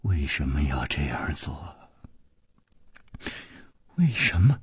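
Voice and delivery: low, whispery, numb question; murmuring. A narrator's reading. Numb, tearful woman asking her companion why, murmuring. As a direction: murmur it barely voiced. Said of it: "喃喃地说".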